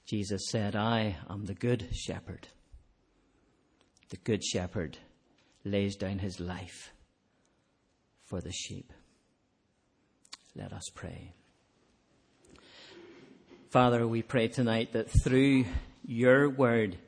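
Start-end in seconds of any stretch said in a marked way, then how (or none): 0.0-11.3 hushed sermon close. Acted. Preacher ending on the good shepherd, hushed. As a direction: let it settle into prayerful stillness.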